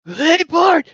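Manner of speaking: very high, loud cartoon squeal; Milhouse. Showing co-hosts a Milhouse impression, loud squeaky voice. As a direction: push it way up into a squeaky cartoon kid. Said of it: "I can't do a Milhouse voice".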